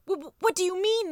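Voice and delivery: high, stammering mouse outburst; squeaky panicked scream. A narrator's reading. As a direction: stammer it out in high-pitched disbelief. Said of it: "the mouse will scream".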